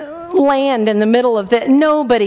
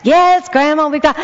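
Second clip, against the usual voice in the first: put-on kid's reply; 6 semitones higher than usual. Kids answering grandma.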